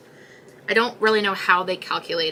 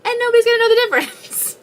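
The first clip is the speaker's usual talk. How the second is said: high, fast, squeaky and louder; sneaky glee; 14 semitones higher, 8 dB louder, faster than usual. Pretend. Planning to repost the video with new audio, squeaking high and fast. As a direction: chirp it up high like a cheeky little scheme.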